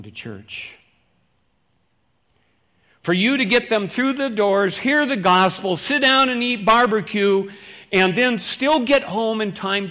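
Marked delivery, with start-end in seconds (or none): 3.0-9.9 louder, higher, building enthusiasm; rising excitement. Real, not acted. Pastor hyping the church lunch to the congregation, loud and excited. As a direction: whip up the crowd about the barbecue.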